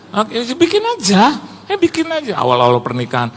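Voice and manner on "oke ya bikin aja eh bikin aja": mock insistence. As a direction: act out a husband insisting from afar. Retold insisting to wife from afar.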